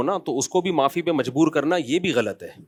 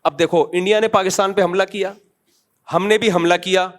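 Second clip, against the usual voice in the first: ordinary talk, nothing marked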